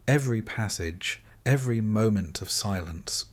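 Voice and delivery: usual speaking voice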